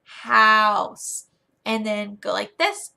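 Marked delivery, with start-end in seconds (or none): 0.0-1.3 loud, stretched-out word for little learners; big, slow teacher enunciation; exaggerated for young kids. Shown as a demonstration. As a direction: draw out HOUSE long and loud, letting it fall. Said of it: "you will really wanna say".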